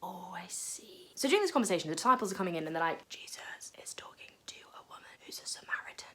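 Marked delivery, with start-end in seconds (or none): 0.0-1.1 very slow, breathless whispered realisation; stunned whisper. Acted. Woman realises he is Messiah. 3.1-6.1 scandalised whispering; gossiping whisper. Acted. Disciples gossip in shock.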